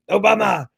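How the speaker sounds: OBAMA thrown out high and sped up; imitated Trump punch. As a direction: mimic Trump bursting out OBAMA, pitched high. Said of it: "I like how he does that".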